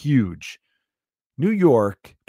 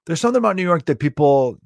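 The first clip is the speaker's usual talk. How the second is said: normal talking voice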